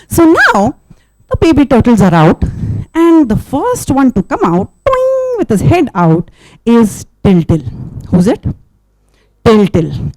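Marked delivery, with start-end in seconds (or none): 4.8-5.4 toing sound. Acted. Turtle pops head out.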